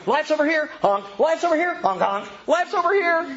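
high honking goose-like devil voice; silly clown. Acted. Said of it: "And Satan's going".

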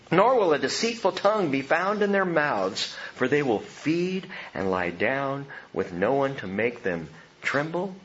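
normal talking voice

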